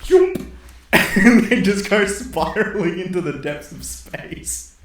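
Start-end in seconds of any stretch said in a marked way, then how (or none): 0.0-0.5 high squeaky cartoon whoosh; a silly high sound effect for the ship spinning away; spaceship swoosh sound.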